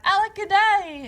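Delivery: put-on high, loud old-fashioned wail of woe; lamenting cry. A narrator's reading. Drip mourning being left out, high loud wail. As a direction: throw the lament up to the sky. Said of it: "And he cried".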